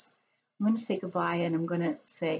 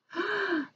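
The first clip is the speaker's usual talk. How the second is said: sharp high gasp of surprise; 13 semitones higher than usual. Acted. Reading picture book to kids.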